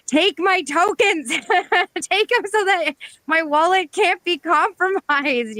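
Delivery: high, slow pleading voice; high mock plea. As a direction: squeak out a desperate plea. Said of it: "you're like".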